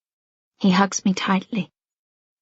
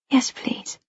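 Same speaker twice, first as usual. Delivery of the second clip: weak whisper; 6 dB quieter than usual. Acted. Weak after panic attack.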